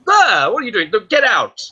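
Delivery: hammed-up startled cake shouting; startled mock yell. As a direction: yell in mock alarm at the pest. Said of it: "He says".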